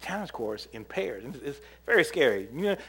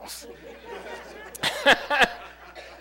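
laughter; 12 semitones higher than usual. Real, not acted. Laughs at story.

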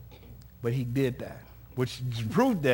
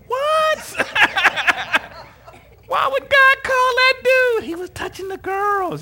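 very high, loud comic disbelief; incredulous outburst; 11 dB louder, slower than usual. Retold or imitated. Preacher acting out his shock that God called that kid, high incredulous yell. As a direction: shriek it in exaggerated indignation. Acts out own disbelief at camper's vision.

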